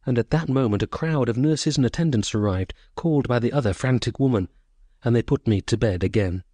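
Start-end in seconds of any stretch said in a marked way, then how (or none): none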